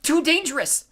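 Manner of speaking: high, loud alarmed wail. A narrator's reading. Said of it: "the Gray sisters wailed".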